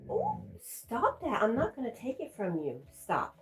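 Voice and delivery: high, squeaky mock voice of a touchy person; mocking exasperated gossip. Mocking the gossips back home in a high squeaky voice. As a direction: lampoon the people who snap at you. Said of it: "people are always like".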